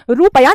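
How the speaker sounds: रुपया said slowly as "roo-pa-ya"; wrong pronunciation. Shown as a demonstration. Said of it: "रुपया नहीं होता". (रुपया को 'रूपया' बोलना)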